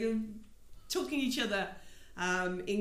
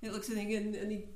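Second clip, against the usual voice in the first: ordinary talk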